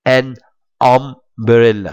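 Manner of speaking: "umbrella" said slowly, its u like the a in "an"; teacher's slow model "An umbrella", u sounded like a. Shown as a demonstration. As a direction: draw out "umbrella", u said as a. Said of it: "خدت بالك من اليو اللي في umbrella اتنطقت زي الآ بالظبط".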